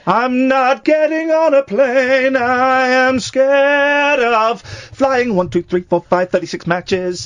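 high, very slow and loud, hammed-up; mock Rain Man. Host spoofing the Rain Man musical, slow loud high voice. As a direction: drag out a fearful Rain Man line. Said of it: "that's the vibe".

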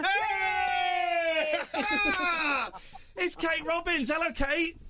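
high-pitched, whooping radio welcome; big delighted hello with a shouted hey; excited greeting. Real, not acted. Host greets guest as call connects.

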